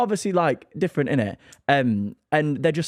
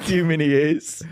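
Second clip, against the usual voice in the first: laughing; 3 semitones higher than usual. Real, not acted. Laughing while asking.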